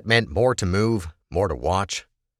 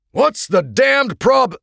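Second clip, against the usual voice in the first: loud, high, slow exasperated shout; heated, high-pitched yell; angry demand; 7 dB louder, much slower than usual. A narrator's reading. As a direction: burst in demanding answers, cut off mid-word. Bursts into cockpit, demanding, cut off.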